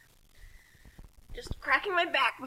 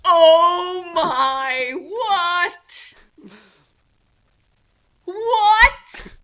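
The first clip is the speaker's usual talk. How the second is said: loud, rising disbelief; shocked; 5 semitones higher, 10 dB louder, much slower than usual. Real, not acted. Reader stunned by the chaos god reveal, loud disbelief. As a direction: react like the twist floored you.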